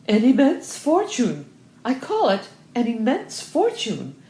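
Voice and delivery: "immense fortune" hit high and loud; emphatic. A narrator's reading.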